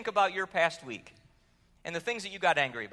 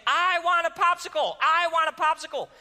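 put-on high, loud child's tantrum wail; mock tantrum scream; 10 semitones higher, 7 dB louder than usual. Daughter on the floor demanding a popsicle, high loud wail. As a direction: scream it like a five-year-old in meltdown. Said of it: "started screaming".

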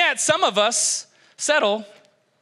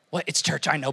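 usual speaking voice

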